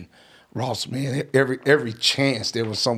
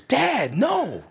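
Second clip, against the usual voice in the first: high, protesting teenager, retold; upset son on phone. Son protesting to dad before he hangs up, upset. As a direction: cry out high like a wronged son.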